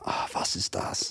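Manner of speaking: a soft, breathy hint of a German tourist; mock German. Imagined Bavarian listener puzzling, lightly mock German. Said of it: "some guy in Bavaria".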